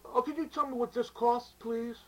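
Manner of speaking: high, fussy customer. Acted. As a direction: whine the question up high. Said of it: "the guy's giving him a hard time".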